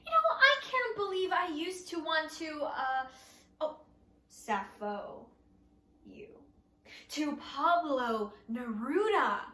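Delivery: ordinary delivery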